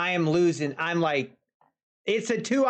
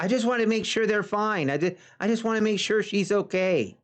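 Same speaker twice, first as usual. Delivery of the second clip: hurried excuse; faster than usual. Retold or imitated. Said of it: "And she's like".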